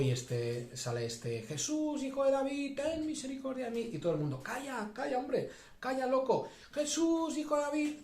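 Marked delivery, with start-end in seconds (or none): 1.4-3.9 imitated desperate cry. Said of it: "son esos gritos, a grito pelao". 4.4-6.5 high-pitched mock scolding; crowd hushing someone. Crowd silencing the crying man. 6.7-7.9 very high, stretched-out mock yell; imitated desperate cry. Preacher as the man persisting over the crowd, high imitated cry. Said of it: "son esos gritos, a grito pelao".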